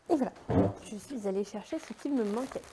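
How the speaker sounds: ordinary delivery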